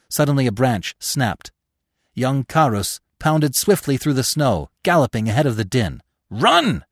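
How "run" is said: high, loud urgent shout; calling out. A narrator's reading. Carus gallops past Bambi from the hunters, calling out. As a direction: shout a warning in passing. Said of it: "he called when he saw Bambi".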